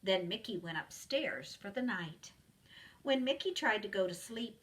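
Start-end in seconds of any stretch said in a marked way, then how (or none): none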